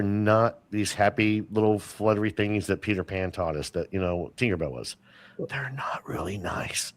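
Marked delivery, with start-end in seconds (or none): 5.5-6.9 whisper. Acted. Warning that fairies are dangerous.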